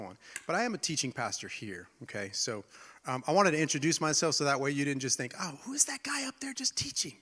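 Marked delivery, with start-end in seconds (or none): 5.4-7.2 breathy mock whisper. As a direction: whisper it like a private thought. Mock thought of newcomer.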